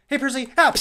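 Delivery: high, loud greeting shout; cheery call. Acted. Ash in a drone body calls out cheerily to Percy. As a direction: hail him brightly from afar.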